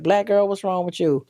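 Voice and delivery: mother demanding. Retold or imitated. Mom confronts crying daughter.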